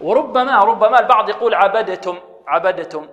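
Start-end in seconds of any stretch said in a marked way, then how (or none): 1.6-3.1 عبدتم with d released before the t; wrong released dal. Shown as a demonstration. Teacher quizzes pupils with the mistake, released d. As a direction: bounce the d in عبدتم, "abade-tum". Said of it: "هل هذا صحيح؟".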